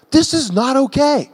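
high-pitched acted indignation; indignant Jesus. Preacher voicing Jesus confronting the money changers, indignant.

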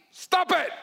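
high, sudden sharp command; emphatic rebuke. Acted. Preacher stops people dwelling on "if only", emphatic rebuke. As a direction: cut the excuses off at a high pitch.

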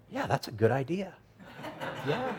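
softly sarcastic, dubious yeah; mock agreement. Prophet answers God's odd order, sarcastically agreeing. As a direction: play along doubtfully, voice dropping soft then lifting.

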